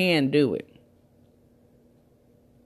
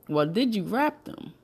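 ordinary talk

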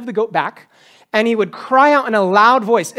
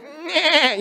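mock straining grunt; 6 semitones higher, 4 dB quieter than usual. Squeezing the goat hard.

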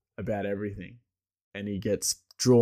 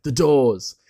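lightly imitated stage announcer, raised and louder; announcer introduction; 4 semitones higher, 6 dB louder than usual. House announcer bringing on the band, mock stage introduction. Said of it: "then he announces, like".

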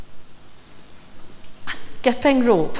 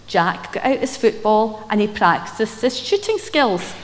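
usual speaking voice

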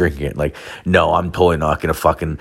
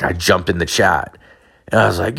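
ordinary talk, nothing marked

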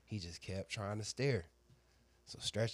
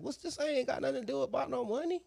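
very high, put-on voice of disbelief; mock outraged squeak; 4 dB louder than usual. Man replaying his baffled reaction to her money jab: very high squeak. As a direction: squeal the protest like it's absurd. Retold own outraged reply.

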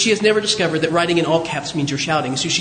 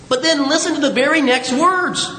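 normal talking voice

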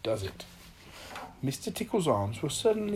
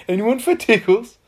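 high, very loud offer; eager call; 8 semitones higher, 12 dB louder than usual. A narrator's reading. Mister Tickle spies victims to tickle, loud eager call. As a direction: call it out with mischief.

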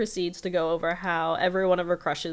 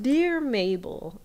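excited letter opening; 7 semitones higher, 4 dB louder, much slower than usual. Retold or imitated. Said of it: "she's so excited".

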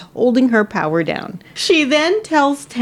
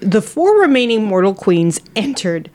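ordinary delivery

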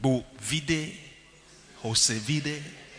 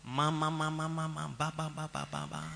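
speaking in tongues babble; 9 dB quieter than usual. Retold or imitated. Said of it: "if you stand outside and people are speaking in tongues".

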